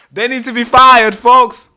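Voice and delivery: loud, high-pitched shout to the audience; outraged shout. Real, not acted. Streamer demands sackings from his audience, outraged shout. Emphatic outburst after sound effect.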